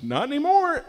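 mock classmate answer; deadpan reply. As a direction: toss it off high and blunt.

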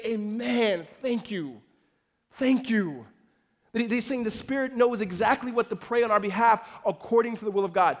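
loud, fervent sermon delivery, building intensity; full-force preaching. Acted. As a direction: preach it out to the whole congregation.

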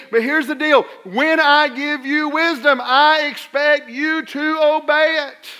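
usual speaking voice

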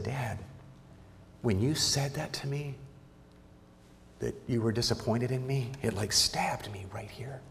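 very soft, half-whispered retelling; hushed imitation of a hurt son. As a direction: voice a wounded child confiding in Dad. Retells hurt son confronting his father.

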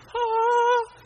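high, sustained angelic "ahh"; heavenly "ah". Retold or imitated. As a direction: sing out a loud, high note of bliss. Awe at the perfect bed.

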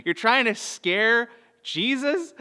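high, slow disbelieving question; incredulous dad. Retold or imitated. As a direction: repeat it back in amused disbelief. Dad questioning son's odd answer.

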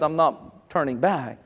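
low, slow and soft, firm; soft steady vow; quiet resolve. Shown as a demonstration. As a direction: say it quietly but settled. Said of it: "maturity not only says".

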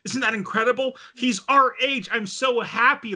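high-pitched fangirl delight; excited gushing. Retold or imitated. Girl telling friends about the wise men, gushing. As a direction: gush as an imitated thrilled fan.

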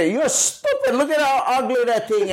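high, sneering mock insult; mock harsh teacher. Mocking a harsh teacher insulting a child's work: high sneer. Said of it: "You know".

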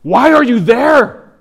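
high, loud, drawn-out yell. Retold or imitated. Mother yelling at her unborn baby, loud high yell. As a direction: yell it slowly as the angry young mother.

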